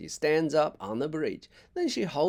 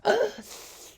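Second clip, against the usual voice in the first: a sudden, much higher gasp; sharp gasp of pain; 4 dB louder than usual. A narrator's reading. Jack when mom touches his ankle, gasping. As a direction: gasp as the sore foot is touched. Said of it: "Jack's gasp".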